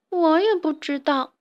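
lost chick on the verge of tears; crying little bird. Acted. Lost little bird answers Ferdinand, tearful. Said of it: "小鸟哭着说".

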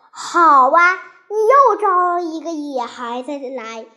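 plain reading voice

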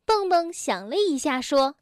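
usual speaking voice

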